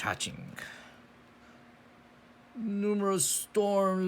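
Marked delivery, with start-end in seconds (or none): 2.5-4.1 high, loud cartoon voice; exaggerated cartoon intonation. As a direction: announce it like a cartoon character. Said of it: "I talk like a cartoon character".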